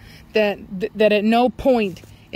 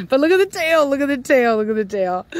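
excited; 3 semitones higher than usual. Real, not acted. Excited at dog's tail.

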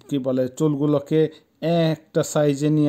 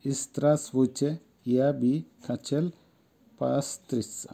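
slow, careful word-by-word model with pauses; pronunciation demo; 4 dB quieter, much slower than usual. Shown as a demonstration. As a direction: break each Russian word up for learners. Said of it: "আসেন উচ্চারণটা দেখি". (ধীরে ধীরে উচ্চারণ শেখানো)

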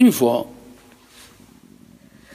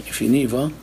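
normal talking voice

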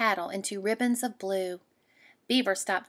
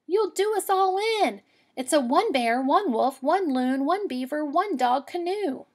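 higher and louder, alarmed; alarmed girl; 6 semitones higher, 4 dB louder than usual. Acted. Girl to the moose, higher, louder, alarmed. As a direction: panic at the extra weight.

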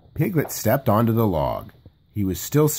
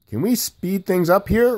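ordinary delivery, nothing marked

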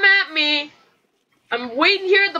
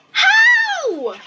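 very high, drawn-out cry; exasperated high shriek; incredulous yell. Real, not acted. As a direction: wail the word in disbelief. Disbelief at being killed.

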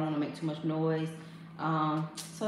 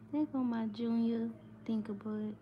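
soft, higher loving coo; tender coo; 5 semitones higher, 5 dB quieter than usual. Real, not acted. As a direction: coo gently, hushed. Cooing over sleeping baby.

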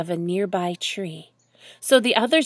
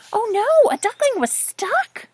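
dismayed reaction; 11 semitones higher than usual. Acted. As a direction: react with hammed-up dismay, pitched way up. Reader reacts to stuck duckling.

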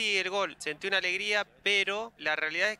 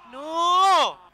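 loud, high cry of dismay; anguished shout; 11 semitones higher, 11 dB louder than usual. Real, not acted. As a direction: yell it as the chance is lost. Near miss while watching.

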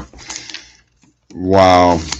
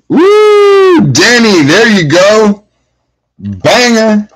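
excited cheer; 11 semitones higher, slower than usual. Real, not acted. Cheering a card pull for Danny, high excited whoop. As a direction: celebrate the hit, pitched way up. Big card hit in pack.

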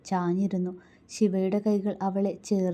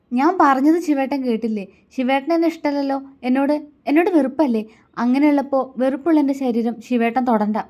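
high, loud and hurt; angry, voice faltering; 5 semitones higher, 5 dB louder than usual. A narrator's reading. Woman throwing his rejection back at him, angry and faltering. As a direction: let anger raise and trip the voice. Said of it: "ശബ്ദം ഇടറുന്നുണ്ടായിരുന്നു".

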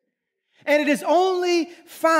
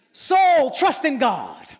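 preaching to self; much slower than usual. Shown as a demonstration. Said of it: "Sometimes you need to preach to yourself".

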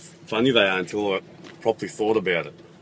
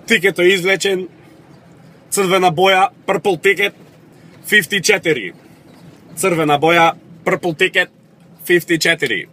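higher-pitched ticket-calling voice; raffle announcer; 6 semitones higher, 3 dB louder than usual. Acted. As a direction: call out the winning ticket to the hall. Acting the raffle number announcer.

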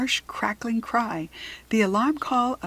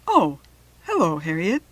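talking through a mouthful of carrot; words round a bite of food; mouth full; 2 semitones lower, 6 dB louder than usual. Acted. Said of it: "with his mouth full".

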